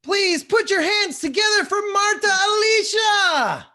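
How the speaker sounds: high, loud, slow emcee shout; rally the crowd for the next name; announcer call. Real, not acted. Host to online audience, calling out the storyteller's name loudly.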